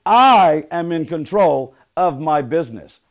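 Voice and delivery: loud, slow chant with a long opening I; emphatic affirmation. Shown as a demonstration. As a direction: declare it firmly for the group to repeat. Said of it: "I want you to say".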